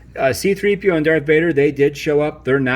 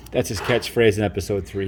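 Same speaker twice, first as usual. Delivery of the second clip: normal talking voice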